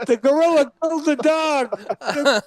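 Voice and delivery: put-on high, loud child's wail; mock a panicked child; crying kid. Imitating a kid shouting about the gorilla, high and panicked. Crying kids voiced in the story.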